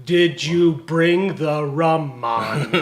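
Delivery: put-on slow Jamaican accent. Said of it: "That's my Jamaican accent".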